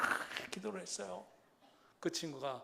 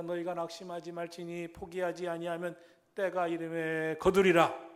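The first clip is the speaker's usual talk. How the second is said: proclaimed scripture; 7 dB louder than usual. Real, not acted. Preacher quoting Galatians to encourage, declaiming. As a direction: build to a loud final word.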